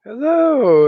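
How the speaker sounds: high, loud, drawn-out hello; excited greeting. Real, not acted. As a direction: burst in with a big host greeting. Hosts greets audience.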